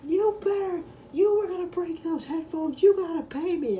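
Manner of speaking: shrill, loud caricature of a furious classmate; mock yelling. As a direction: shout the demand way up high. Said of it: "He's, like, yelling at me".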